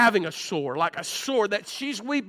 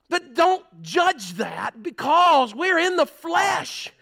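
preaching at full force; 4 semitones higher than usual. Acted. Preacher warning the church about bitter words, full force. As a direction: drive it home to the congregation.

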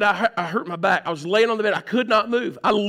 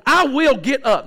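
determined; 5 semitones higher, 6 dB louder, slower than usual. Retold or imitated. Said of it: "And I'm going".

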